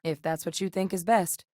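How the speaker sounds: normal talking voice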